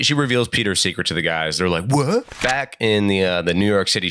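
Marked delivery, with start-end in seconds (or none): 1.9-2.2 shocked what. Retold or imitated. Heroes shocked.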